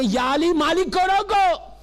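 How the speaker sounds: high, projected storytelling sermon; preaching. Acted. Preacher telling the congregation of Malik at Siffin, preaching. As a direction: build the drama for the crowd.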